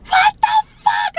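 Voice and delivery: very high strained acted scream. Patient to doctor pulling her ankle, high pained screaming. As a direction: shriek the words as if in agony. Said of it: "I'm screaming, like".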